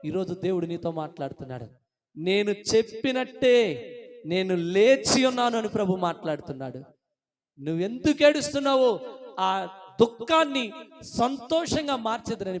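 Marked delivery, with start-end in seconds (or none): 2.2-12.6 emphatic declamation with stretched key words; impassioned preaching. Real, not acted. The risen Lord promises joy to the weeping, impassioned preaching. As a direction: proclaim the Lord's promise forcefully.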